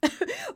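high burst of laughter; hearty laugh. A narrator's reading. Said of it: "with a heartfelt laugh".

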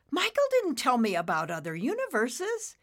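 high, loud bewilderment; astonished protest. Acted. Nimbus learning of other universes, astonished. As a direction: blurt it out in surprise.

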